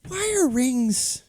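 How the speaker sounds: very high, slow mock squeak; squeaky voice. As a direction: squeak it out slowly in disbelief. High mock voice questioning rings.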